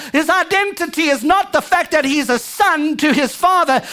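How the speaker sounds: ordinary talk, nothing marked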